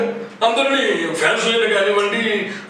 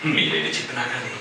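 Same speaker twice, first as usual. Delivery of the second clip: Suman style line; 5 semitones lower, 6 dB quieter than usual. Retold or imitated. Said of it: "Suman గారికి చెప్తే".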